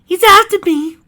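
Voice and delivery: high, full-volume shriek; panicked high-pitched yell; terrified scream. Acted. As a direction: scream it while fleeing a killer. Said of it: "she screamed".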